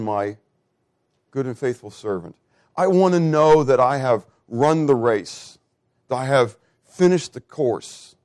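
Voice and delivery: ordinary delivery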